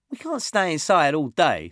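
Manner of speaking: put-on high, loud scoffing kid. Josh mocking staying inside, scoffing kid. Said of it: "scoffed Josh".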